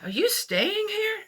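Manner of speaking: high, lightly voiced stranger's question; quoting the stranger. Stranger at the motel asking the teller, lightly voiced high question. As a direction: ask it up high like a curious stranger. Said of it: "He says".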